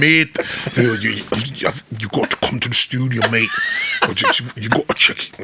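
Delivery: halting mock-Scottish invitation; imitated Scot, not quite landing it; attempted Scottish accent. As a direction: put on a rough Scottish accent. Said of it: "I got it now".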